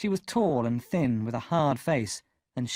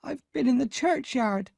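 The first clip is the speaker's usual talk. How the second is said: higher, tearful answer; crying; 12 semitones higher than usual. A narrator's reading. Boy answering his angry sister, tearful. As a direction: answer through tears. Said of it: "I answered, crying".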